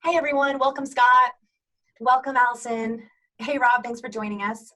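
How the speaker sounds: high, loud, upbeat greetings; bright, projected host voice; welcoming meeting host. Shown as a demonstration. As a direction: welcome each person as the one leading the call. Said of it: "being the driver sounds like this".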